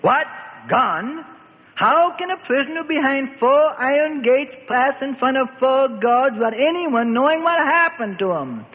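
a king raging at his servant, voice climbing. A narrator's reading. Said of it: "Herod raged".

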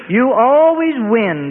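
slow, drawn-out pretend grumble; old rabbi pretending to lose to Joseph; mock lament. A narrator's reading. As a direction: fake the defeat with a wink. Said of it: "the old rabbi said in pretense".

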